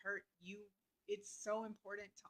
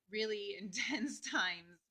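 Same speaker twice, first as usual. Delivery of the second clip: real laugh mid-phrase, slow and louder; 5 dB louder, slower than usual. Real, not acted. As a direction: laugh breaking into drawn-out words. Laughs while summing up hard times.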